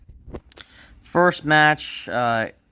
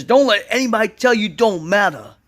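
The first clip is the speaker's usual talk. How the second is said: high, loud preachy wrestler promo; imitating R-Truth; 8 semitones higher, 5 dB louder than usual. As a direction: deliver his heartfelt comeback speech loudly. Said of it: "He says".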